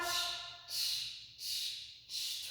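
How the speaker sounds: breathy unvoiced shh sounds like a robot; robot shushing. Teacher making robot breath for kids, unvoiced shh. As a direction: hiss out short robot shushes.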